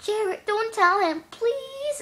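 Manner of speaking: high, whiny begging; re-enacted desperate little plea; retold pleading. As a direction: plead in a squeaky voice, stretching the end. Re-enacts begging classmate not to tell.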